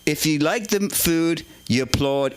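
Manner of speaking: normal talking voice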